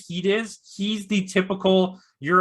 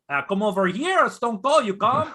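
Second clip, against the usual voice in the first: a light mock French Canadian accent, a bit higher; 5 semitones higher than usual. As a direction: call him over with a hint of French Canadian accent. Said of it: "he has a weird accent".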